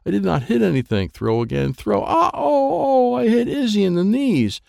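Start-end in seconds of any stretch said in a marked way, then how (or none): 2.0-4.6 dismay. Acted. Narrator hits friend by mistake.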